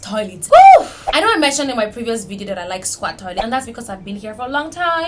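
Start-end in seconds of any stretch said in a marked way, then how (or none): 0.5-0.9 loud, very high whoop; excited woo. Real, not acted. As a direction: cheer like a party horn. Announcing squat toilets topic.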